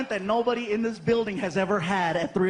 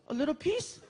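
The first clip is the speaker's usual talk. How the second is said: put-on kid's voice; 6 semitones higher, 4 dB quieter than usual. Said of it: "He goes".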